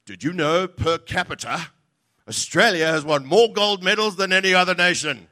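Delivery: hammed-up boastful sports announcer; proud announcer boast. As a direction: trumpet the per capita stat like a proud commentator. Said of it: "And then".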